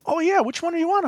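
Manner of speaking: high, loud, keen imitation; eager father-in-law. Husband imitating his wife's dad the Fast fan, excited.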